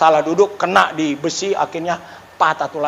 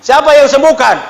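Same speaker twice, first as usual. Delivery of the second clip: rousing call to crowd; 7 semitones higher, 6 dB louder than usual. Acted. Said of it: "Tuhan Yesus".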